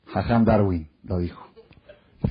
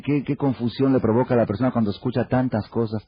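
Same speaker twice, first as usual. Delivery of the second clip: ordinary delivery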